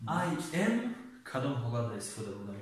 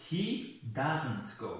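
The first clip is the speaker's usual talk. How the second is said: slow model sentence; much slower than usual. Shown as a demonstration. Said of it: "سوم شخص البته".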